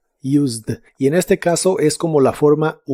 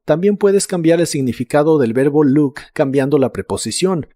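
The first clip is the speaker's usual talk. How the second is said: usual speaking voice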